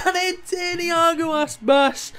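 high, loud, rising commentary whoop; excited goal call. Real, not acted. Manager celebrating his striker's goal, high excited shout.

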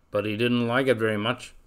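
plain reading voice

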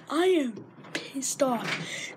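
slow, each word weighed; slow emphatic. Real, not acted. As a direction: spell out the anger slowly. Slow declared anger.

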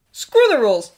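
defiant outburst. A narrator's reading. Draco dismissing Hermione's rules, defiant. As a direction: throw it back at her loudly.

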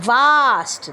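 Vast with a voiced v; vast demo. Shown as a demonstration. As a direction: model Vast clearly. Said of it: "ভাস্ট". (ভা দিয়ে Vast)

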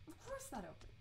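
soft self-mocking echo; quiet repeat. Real, not acted. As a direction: drop it to a wry aside. Repeats remark, second take quieter.

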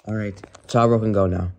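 ordinary delivery

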